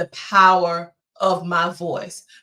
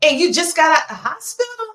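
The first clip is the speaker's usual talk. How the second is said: higher, incredulous question; husband's disbelief; 8 semitones higher than usual. Retold or imitated. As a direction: voice the husband's alarm, pitched up. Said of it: "my husband was like".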